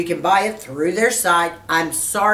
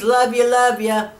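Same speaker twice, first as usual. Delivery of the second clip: tender farewell; 5 semitones higher than usual. Real, not acted. Farewell to subscribers.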